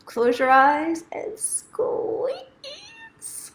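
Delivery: very high, very slow, stretched out; drawn-out squeeze. Acted. Telling viewers to hug themselves, drawn-out and high. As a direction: savour the squeeze.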